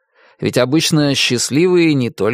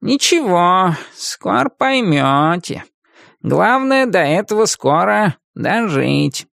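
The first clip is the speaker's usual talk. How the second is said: mock optimism; 6 semitones higher, slower than usual. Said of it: "весьма оптимистично объяснил кот".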